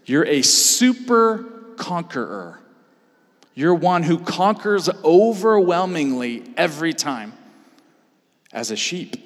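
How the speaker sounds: fervent pulpit cry to the congregation; preaching at full force. Acted. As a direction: preach it out to the whole room, building.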